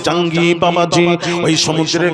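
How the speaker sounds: loud, ringing sermon chant; fervent loud proclamation; preaching at full force. Acted. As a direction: proclaim it to the whole crowd. Loud preaching of saint's lineage.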